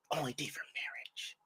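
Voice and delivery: hushed mock whisper. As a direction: breathe it out secretly. Quoted advice, whispered.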